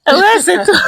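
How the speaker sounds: high, loud laughing reaction; amused exclamation through laughter. Real, not acted. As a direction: burst out laughing on the words. Laughs at the guest's admission.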